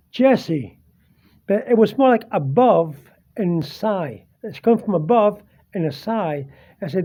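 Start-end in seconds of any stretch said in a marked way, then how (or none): none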